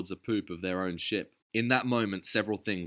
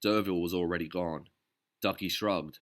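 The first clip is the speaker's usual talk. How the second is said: ordinary delivery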